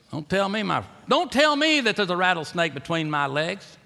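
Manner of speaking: high, loud revival preaching; fiery preaching. Acted. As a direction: preach it with rising fervor. Preacher holds high, loud delivery.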